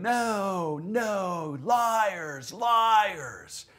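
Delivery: high, drawn-out mock scream in a woman's voice. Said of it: "a straight up woman's voice screaming".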